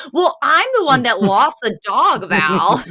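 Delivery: mock-indignant self-defence; imitated exasperation. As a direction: snap back at a friend, exasperated. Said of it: "her exasperation with Val in that moment".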